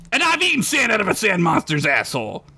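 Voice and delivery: high, scornful comic rant; an orc mocking a timid hero; ranting big orc.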